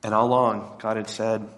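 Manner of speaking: normal talking voice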